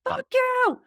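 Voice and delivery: high, loud angry snap back; mock angry retort. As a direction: shout it back up the shaft, furious. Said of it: "And you're like".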